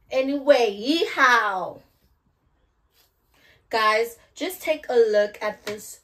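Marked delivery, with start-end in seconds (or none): 0.1-0.7 loud hammed-up Anyway with falling end. Restarting her video with a big loud Anyway. Repeats the word loudly for effect. 0.7-1.8 hammed-up loud cowboy holler; loud yeehaw. Kicking off the wig talk with a loud yeehaw.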